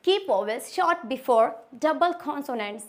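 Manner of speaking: reading the rule slowly; slow, deliberate classroom dictation. Shown as a demonstration. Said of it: "fourth rule".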